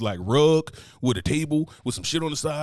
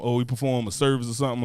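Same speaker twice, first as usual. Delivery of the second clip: ordinary delivery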